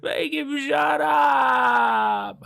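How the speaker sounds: very high, loud, endlessly stretched scream; frantic scream. A narrator's reading. Hutch still screaming at the voice, hands over ears. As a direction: scream it out, holding the last word. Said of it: "Hutch just kept on screaming".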